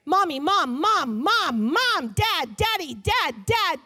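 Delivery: put-on whiny, singsong child calling for attention; pestering child. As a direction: nag like a kid tugging at a parent.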